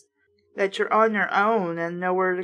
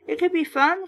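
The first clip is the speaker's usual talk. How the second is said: ordinary delivery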